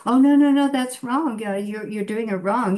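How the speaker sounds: high-pitched, fussy "no, no" interruption; mock correcting. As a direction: jump in loud and high, then ease slightly. Acting out correcting a fellow actor.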